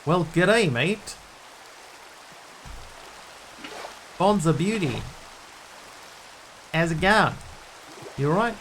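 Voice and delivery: playful stock Aussie greeting; Australian accent. Pretend. Streamer greets chat in a mock Australian accent. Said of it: "all authentic Australian phrases".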